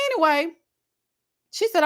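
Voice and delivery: ordinary talk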